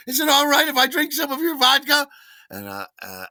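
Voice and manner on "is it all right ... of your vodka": imitating Morticia. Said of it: "And she said".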